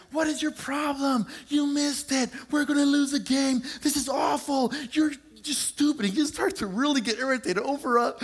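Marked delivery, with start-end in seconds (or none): 0.1-6.0 high, angry shout at his brother; kid yelling at brother. Retold or imitated. Brother shouting at brother over a video game, high angry yell. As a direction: blow up over a missed pass. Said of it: "the other one turns to him and says".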